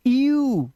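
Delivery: यू said high as a held ee-oo; ee-oo sound. Shown as a demonstration. Teacher showing how EW is read, drawn-out ee-oo for यू. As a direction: glide slowly from ee to oo in यू. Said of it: "ध्यान से देखो, इसे पढ़ते".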